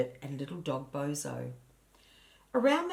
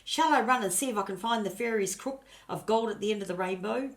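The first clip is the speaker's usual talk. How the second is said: calling out; 5 semitones higher, 5 dB louder than usual. A narrator's reading. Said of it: "she called to Gran".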